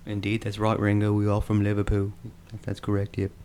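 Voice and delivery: light Liverpool accent. Shown as a demonstration. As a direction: play a bandmate agreeing in Liverpool accent. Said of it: "the Liverpool".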